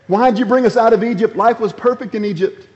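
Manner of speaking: high mock whine of ungrateful people; mocking whiny Israelites. Preacher mocks the Israelites' complaint to Moses, in a high whine. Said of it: "when they said".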